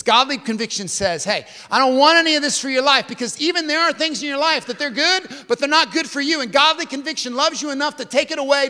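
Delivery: high, loud, driving sermon delivery; full-force preaching. Real, not acted.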